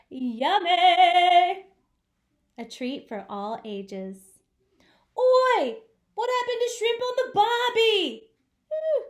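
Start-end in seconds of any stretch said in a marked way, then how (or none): 0.1-1.6 loud, bright squeal of delight; a hammed-up shout of delight over sprinkled bread; gushing yummy. 5.2-9.0 loud, high, half-sung Aussie yell; hammed-up Aussie shout, sing-song; mock Aussie outcry.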